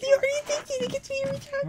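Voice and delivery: high laughing squeal. Real, not acted. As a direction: squeak it through giggles. Repeating phrase, laughing.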